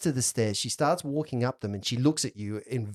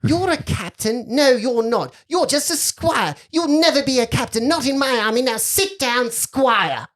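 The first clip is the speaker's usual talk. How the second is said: loud, higher-pitched haughty put-down; imperious girl, scolding; 11 semitones higher, 5 dB louder than usual. Acted. Girl to squire pretending to be captain, scornful. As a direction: dress him down as a bossy little lady.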